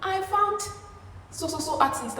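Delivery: high-pitched mock gushing. Telling Orange about the artist, gushing. Gushing news told to roommate.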